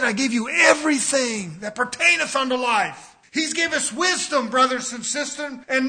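voicing God's expectations; fiery preaching. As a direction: preach it with force. Preaching at full force.

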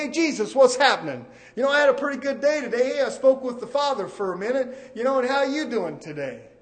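hammed-up buddy talk, fast, like greeting a pal; mock casual prayer to Jesus. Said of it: "does that sound like ... you're mocking".

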